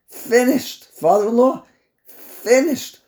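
high-pitched, incredulous exclamations; aghast disbelief. Real, not acted. Aghast at the boy's nusach.

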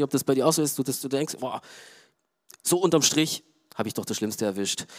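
normal talking voice

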